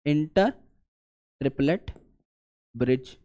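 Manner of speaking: "Inter", "triplet", "bridge" each alone with long pauses; word by word. Shown as a demonstration. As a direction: space "inter triplet bridge" out for note taking. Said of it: "यहां पर हम लोग इसे कह रहे होंगे".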